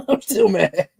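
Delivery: slow, laughing through the words; chuckling as he admits he's still mad. Real, not acted. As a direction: laugh it out while saying it.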